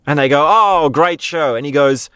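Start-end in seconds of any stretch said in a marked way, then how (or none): 0.5-1.6 higher, drawn-out mock admiration; mock crowd cheer. Crowd applauding what they think is an act, mock delight. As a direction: imitate a delighted audience, stretching it out. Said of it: "the whole crowd claps and cheers".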